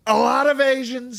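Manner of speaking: slow throaty Trump; Trump impression. Shown as a demonstration. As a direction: Trump rally voice.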